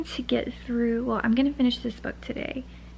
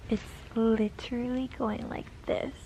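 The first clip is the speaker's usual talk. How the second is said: ordinary talk, nothing marked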